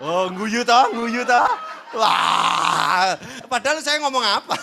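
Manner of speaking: loud, laughing amusement. Real, not acted. The preacher laughs at how lovers talk, loud and amused. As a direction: laugh through the words.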